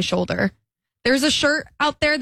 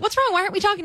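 imitating a worried friend; 9 semitones higher, much faster than usual. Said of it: "they're like".

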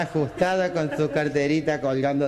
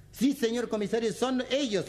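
much higher eager camp voice; camp caricature; 11 semitones higher, 4 dB quieter than usual. Acted. Camp victim confirming suspects to the commissioner, much higher voice.

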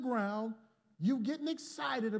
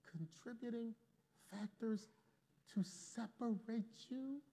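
much softer, leaning in; hushed preaching; 11 dB quieter than usual. Acted. Preacher hushing the congregation to stress the point. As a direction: drop to a hush to make the point.

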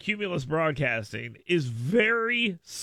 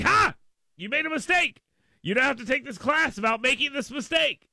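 mock gotcha; 7 semitones higher than usual. Host mimicking company catching staff out, mock gloating. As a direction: pounce on it in a raised, taunting voice. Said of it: "so they can be like".

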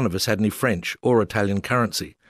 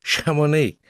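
put-on higher, French-accented, insistent; French official repeating; 6 semitones higher, slower than usual. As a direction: repeat the town name firmly in French. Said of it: "he said again".

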